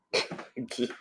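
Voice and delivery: soft, breathy chuckle; stifled laugh. Real, not acted. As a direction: chuckle quietly to yourself. Amused by his own remark.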